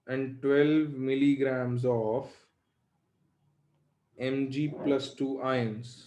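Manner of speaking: slow, word by word for copying; slow dictation. Shown as a demonstration. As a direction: dictate it slowly so they can write. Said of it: "लिखो". (धीरे धीरे लिखवाना)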